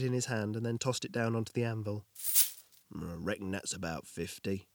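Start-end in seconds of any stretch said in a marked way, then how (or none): 2.9-4.7 low, soft murmur. Acted. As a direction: murmur it under the breath. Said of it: "he murmured".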